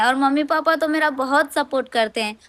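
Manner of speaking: ordinary talk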